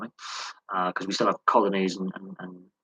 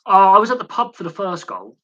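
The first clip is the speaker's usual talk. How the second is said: excited; 10 semitones higher, 10 dB louder than usual. Real, not acted. Excited recollection of the match.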